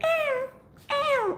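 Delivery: very high squeaky cat cries; girly cat voice. Retold or imitated. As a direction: squeak it way up like a little girl. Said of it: "it'll sound like a girl".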